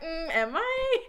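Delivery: high, unsure little "am I?"; mock her self-doubt with a laugh; a light laughing imitation of a friend second-guessing herself; doubtful friend. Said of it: "she's always like".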